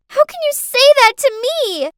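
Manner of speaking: put-on high, loud, wounded little-girl yell; child's shout. Girl accuses her carer of betrayal, shouting. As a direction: shriek it at the grown-up. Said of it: "Clarice shouted".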